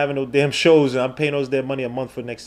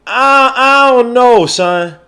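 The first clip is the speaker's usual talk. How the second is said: slow, high, loud drawn-out disbelief; 10 semitones higher, 9 dB louder, much slower than usual. Real, not acted. Streamer slowly groaning he can't explain the players. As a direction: stretch it out, exasperated.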